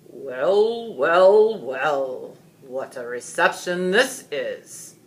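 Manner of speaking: loud, deep-set dragon voice drawn out slowly; a cold reptilian beast savoring the moment, booming. A narrator's reading. Dragon greets the frightened crowd, cold and booming. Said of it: "cold, deep, reptilian voice thundered".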